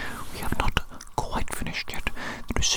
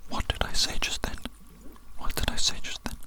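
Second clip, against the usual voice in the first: ordinary talk, nothing marked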